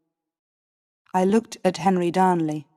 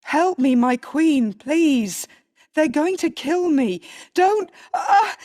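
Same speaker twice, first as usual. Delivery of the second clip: terrified screaming; 9 semitones higher than usual. Acted. Riccio begging the queen as they kill him, panicked screams. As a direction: beg in rising panic, breaking off. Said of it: "he screamed".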